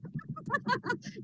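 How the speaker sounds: light high giggle; soft high laugh. Real, not acted. Coach laughing at her own class story. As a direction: laugh softly at the memory.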